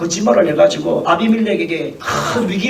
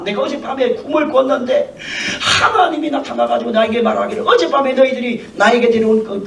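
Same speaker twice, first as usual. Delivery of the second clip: normal talking voice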